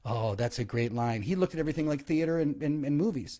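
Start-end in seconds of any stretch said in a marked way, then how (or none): 0.1-1.2 low, slow, pleased president; lightly voiced president admiring a line; Reagan. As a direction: drop lower and take it slowly. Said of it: "Reagan was like".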